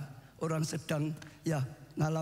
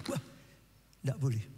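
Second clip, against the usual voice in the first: ordinary talk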